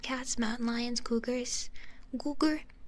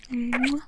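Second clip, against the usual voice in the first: loud smacking kiss into the mic; kiss sound; 7 dB louder than usual. Acted. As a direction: blow a big kiss at the listener.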